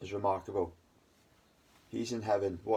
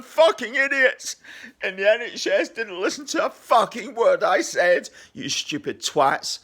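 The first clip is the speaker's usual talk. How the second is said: very high, loud, slow furious yelling; furious shout; 12 dB louder, slower than usual. Real, not acted. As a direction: scream it out in raw rage at the idiots. Angry rant at wife's stress, NHS.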